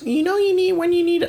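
high, prissy mock dentist; squeaky lecturing dentist impression. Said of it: "they're like".

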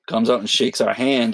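ordinary delivery

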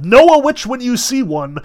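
high furious witch howl. A narrator's reading. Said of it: "with a howl of".

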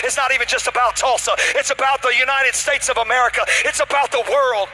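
fired-up sermon delivery; full-force preaching. Real, not acted. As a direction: drive it relentlessly up to a shouted climax.

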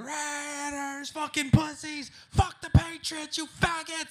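high, slow loud jeers; insulting yell. Retold or imitated. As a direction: hurl abuse at the visitor. Rival fans insulting him.

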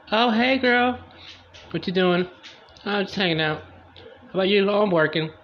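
put-on two girlfriends catching up; chatty gossip girl. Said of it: "Fire's like".

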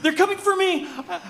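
ordinary delivery

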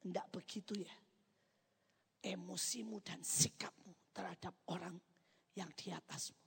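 very soft, measured correction; God's gentle rebuke. Retold or imitated. God correcting a child's attitude, hushed and measured. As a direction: drop to a hushed, deliberate admonishment. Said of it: "Dan Tuhan bilang".

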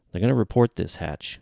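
plain reading voice

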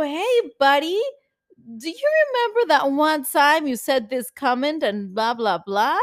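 loud, high, needling question; mock-casual challenge. Girlfriend testing her partner over an old comment, needling. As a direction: pick a fight in a falsely friendly tone. Acts out picking a fight.

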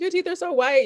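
high, gushing voice; mock compliment. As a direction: gush like an admirer. Voicing people's compliments.

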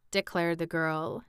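usual speaking voice

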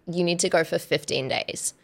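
ordinary delivery

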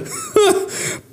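breathy scornful laugh; hollow laugh. Acted. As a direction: laugh in disbelief.

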